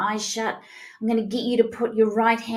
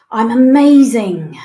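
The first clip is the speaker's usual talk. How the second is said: declared out loud, much louder; loud affirmation; 2 semitones higher, 13 dB louder than usual. Shown as a demonstration. Coach showing the group the affirmation, loud and proud. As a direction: proclaim it with full belief. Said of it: "say either to yourself or out loud".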